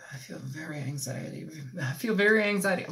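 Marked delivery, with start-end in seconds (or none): 1.8-2.9 Southern accent creeping in. Real, not acted. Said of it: "speaking in a fucking Southern accent".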